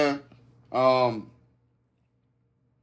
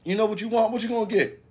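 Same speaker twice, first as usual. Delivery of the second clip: higher-pitched imitation of a girlfriend; 9 semitones higher than usual. Girlfriend asking her man what he wants, lightly imitated in higher voice. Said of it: "She like".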